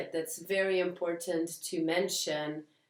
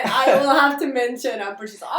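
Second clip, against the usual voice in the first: laughing; 7 semitones higher, 13 dB louder than usual. Real, not acted. Self-mocking catchphrase, laughing.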